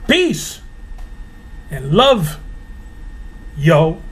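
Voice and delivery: laid-back street-style peace and love, yo; breezy slangy farewell; casual sign-off. Acted. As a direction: drop the churchy tone and keep it casual. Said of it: "Is that a little better?".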